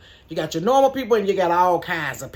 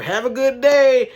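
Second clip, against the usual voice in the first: loud, high, drawn-out farewell; cashier's sign-off; 7 semitones higher, 8 dB louder than usual. Retold or imitated. Leaning cashier sending off a customer, mock sign-off. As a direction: sing out a slow, higher customer goodbye.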